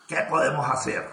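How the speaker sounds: low, exclaiming angry worm; furious earthworm. A narrator's reading. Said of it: "exclamó una furiosa lombriz de tierra". (lombriz furiosa, voz baja de tono)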